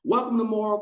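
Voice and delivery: high mock host opening the show; cheesy talk show host. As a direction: ham up a TV host welcoming the crowd. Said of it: "the guy's like".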